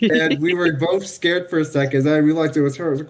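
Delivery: normal talking voice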